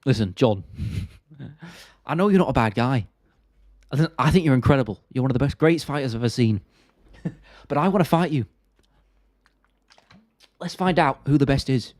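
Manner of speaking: lightly put-on earnest fighter voice; mock-sincere appeal to an opponent; Tom Aspinall impression. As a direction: imitate a fighter sincerely coaxing a rival. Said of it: "impressions of Tom Aspinall trying to convince Jones".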